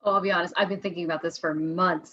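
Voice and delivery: ordinary delivery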